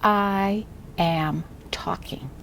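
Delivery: slow, word-by-word read-aloud; each word drawn out and separated; model reading. Shown as a demonstration. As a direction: read it very slowly for a beginner to echo. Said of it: "I read first, and then you read after me".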